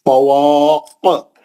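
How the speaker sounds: slow, drawn-out recitation with a bouncing qalqala at the end; tajweed recitation, strong qalqala on the final letter; Quran recitation. Shown as a demonstration. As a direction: recite it slowly and let the last letter echo. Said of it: "अब मैं नीचे की मिसालें पढ़ा रहा हूँ".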